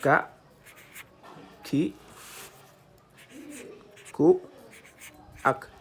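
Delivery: teacher drilling syllables. Shown as a demonstration. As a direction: call out each syllable.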